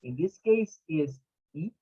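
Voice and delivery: normal talking voice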